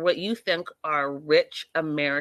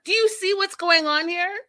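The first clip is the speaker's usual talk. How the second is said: higher, louder upset plea; 11 semitones higher, 4 dB louder than usual. Retold or imitated. Son losing it with his mother, higher exasperated plea. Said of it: "Farouk loses it".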